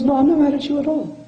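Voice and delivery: put-on quoted old man. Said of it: "He goes".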